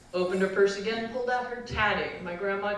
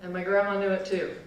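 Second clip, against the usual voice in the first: ordinary delivery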